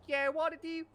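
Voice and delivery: very high, loud echo; mimicked catchphrase. Officers parroting the man's greeting, high and loud.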